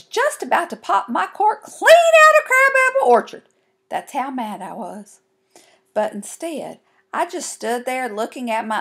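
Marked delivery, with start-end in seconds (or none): 0.0-3.5 high, loud exasperated outburst; boiling mad. A narrator's reading. Girl telling how mad she was, exploding. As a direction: blow up, climbing to a loud high peak.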